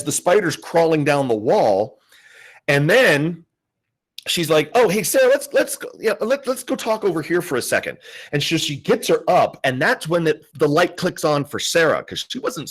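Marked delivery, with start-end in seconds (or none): 4.7-8.0 high, breezy cover-up voice; fake casual. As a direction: friend luring Sarah away from the spider, fake casual. Friend coaxing Sarah away calmly.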